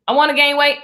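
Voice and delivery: lifted pitch, mock-insistent wanting; mock eager wish. Acting out flip-flop energy, mock eager wish. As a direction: punch it up as the wanting side.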